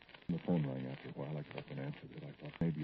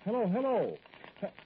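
loud, high shouts into the receiver; raised voice, mounting to a long high yell; frantic phone hello; 7 dB louder than usual. Acted. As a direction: call out urgently to a caller who is gone. Calling into phone after caller's threat.

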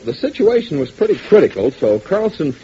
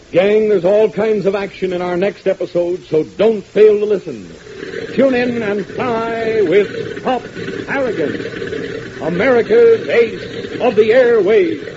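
radio announcer; 6 semitones higher, slower than usual. Acted. Announcer closing pitch.

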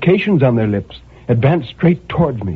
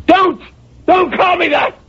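a pained, high cry of refusal; anguished cry. Acted. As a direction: cry out to stop him. Narrator protests being pitied.

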